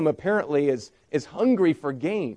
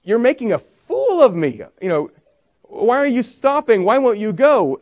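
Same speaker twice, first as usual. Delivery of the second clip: louder, higher exasperated demand; angry Balaam; 6 semitones higher, 5 dB louder than usual. Acted. Balaam shouting at his donkey that won't move, louder and higher. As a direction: yell at the stubborn animal. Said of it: "And Balaam responds".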